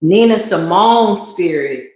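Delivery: ordinary talk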